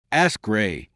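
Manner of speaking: ordinary delivery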